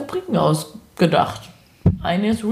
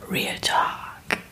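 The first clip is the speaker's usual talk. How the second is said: dramatic whisper of Real Talk; 7 dB quieter than usual. Pretend. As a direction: breathe the title like a secret. Repeats segment name in a whisper. (geheimnisvoll geflüstert)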